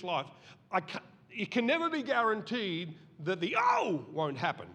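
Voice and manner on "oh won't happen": mock offended oh. Sound of being offended.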